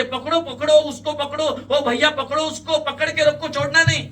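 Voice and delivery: high, loud cries from a window; shouting. A narrator's reading. Young woman at window to crowd, shouting. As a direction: scream for the crowd to seize him. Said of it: "ती तरुणी तिकडून ओरडत असते".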